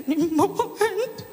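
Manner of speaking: breaking down. Acted. She breaks down mid-confession.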